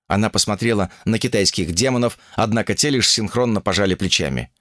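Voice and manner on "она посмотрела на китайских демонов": ordinary delivery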